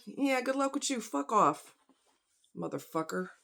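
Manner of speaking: muttering quietly; muttered under breath. Retold or imitated. Muttered retort at the doctor.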